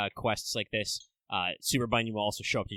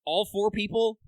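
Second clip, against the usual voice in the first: normal talking voice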